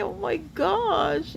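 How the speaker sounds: usual speaking voice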